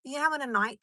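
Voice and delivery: usual speaking voice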